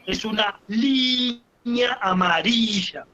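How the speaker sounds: very slow, long I in "línea" and "amarilla"; stress on I. Shown as a demonstration. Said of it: "el acento de las palabras".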